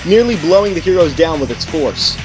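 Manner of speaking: ordinary delivery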